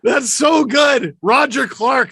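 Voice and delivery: high, loud, excited exclamation; bursting with fan delight; thrilled. Real, not acted. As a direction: rave about it at full pitch. Thrilled by the video just played.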